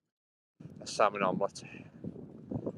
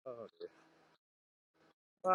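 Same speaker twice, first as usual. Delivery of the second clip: ordinary talk, nothing marked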